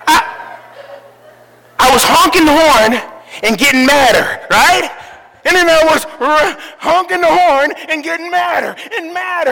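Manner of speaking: loud, building mock fury; getting madder. Building anger in retelling.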